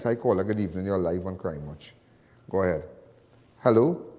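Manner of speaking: easy on-air host greeting; radio host intro. Acted. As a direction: welcome the caller on air. Opens phone lines on show.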